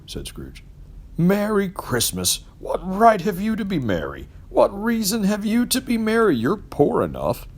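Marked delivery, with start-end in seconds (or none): 1.2-7.5 mocking scorn. A narrator's reading. Scrooge mocks nephew's cheer.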